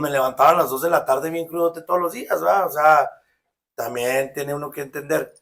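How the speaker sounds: normal talking voice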